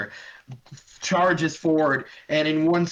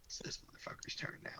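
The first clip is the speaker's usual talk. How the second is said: whisper; 23 dB quieter, faster than usual. Real, not acted. GM aside whispered to self.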